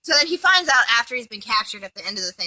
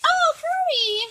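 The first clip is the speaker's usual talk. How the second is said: swooning call; 4 dB louder, slower than usual. Retold or imitated. Voices swooning character.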